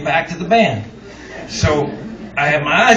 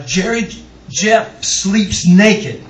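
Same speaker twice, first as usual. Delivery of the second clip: ordinary talk, nothing marked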